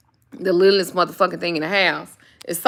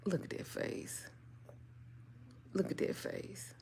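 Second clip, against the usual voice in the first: hushed adoring whisper; whispered coo; 22 dB quieter than usual. Real, not acted. Admiring her dog's face in a hushed whisper. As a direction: whisper it softly over the dog. Cooing over the dog.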